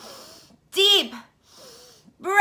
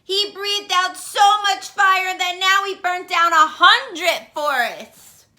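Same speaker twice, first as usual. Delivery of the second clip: ordinary delivery, nothing marked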